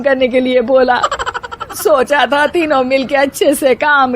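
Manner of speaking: put-on high, groaning old woman's lament; lamenting mother-in-law. A narrator's reading. Mother-in-law laments to the three bahus about her pain. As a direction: moan and scold through the pain.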